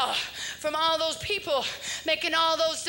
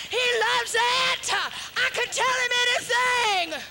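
preaching at full force; 7 semitones higher than usual. Acted. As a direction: shout the joy out to the church.

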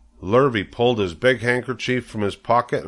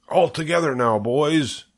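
higher-pitched call to the crew; rallying call; 6 semitones higher than usual. A narrator's reading. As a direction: get the men lifting together. Farmer rallying men to lift.

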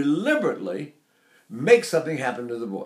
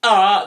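one loud "ah" with a hard onset; glottal stroke; 4 semitones higher, 8 dB louder than usual. Shown as a demonstration. Singing teacher shows what a glottal stroke is, hard-attack "ah". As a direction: pop the "ah" open from the throat. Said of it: "that's a glottal stroke".

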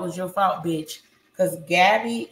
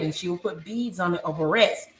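normal talking voice

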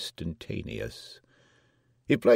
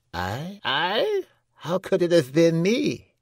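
high dwarf's voice, growing louder; protesting dwarf; 12 semitones higher, 6 dB louder than usual. A narrator's reading. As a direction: innocent protest from the accused jester. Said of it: "merely ejaculated".

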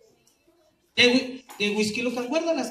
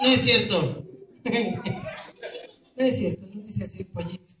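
normal talking voice

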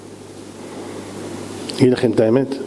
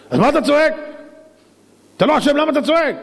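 high-pitched scolding question; exasperated, acted protest; indignant challenge; 9 semitones higher, faster than usual. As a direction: demand an answer, voice raised high. Acted quarrel over a screaming patient.

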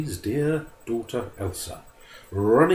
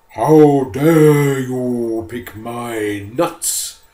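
slow, booming bear roar, louder; 3 semitones higher, 9 dB louder, much slower than usual. Acted. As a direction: bellow it like an angry bear. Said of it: "roared the bear".

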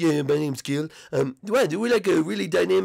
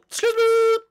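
calling out; 3 dB louder than usual. Acted. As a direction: Excuse me called out higher and a bit louder. Calls again, louder.

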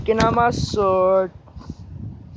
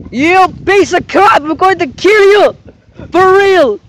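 higher, louder furious threat; furious shout; 7 semitones higher, 5 dB louder than usual. Acted. Toy villain screams threats at Mario, furious shout. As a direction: yell it like you want to kill him.

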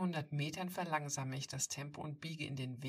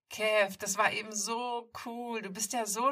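bubbly high sing-song praise; trilling; 7 semitones higher, 10 dB louder than usual. Acted. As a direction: trill it out, gushing with delight. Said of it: "trällert sie".

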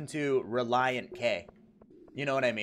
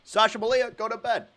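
higher, halting presidential voice; Obama; 6 semitones higher than usual. Acted.

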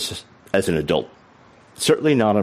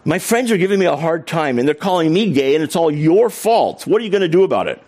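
mock indignant accusation, pitched up; retold angry rant; 8 semitones higher than usual. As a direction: imitate an upset man complaining in a higher voice. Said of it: "he started in. He says".

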